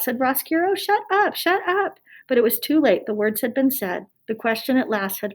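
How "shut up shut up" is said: urgent hush-up. Acted. Roscuro cutting the question off.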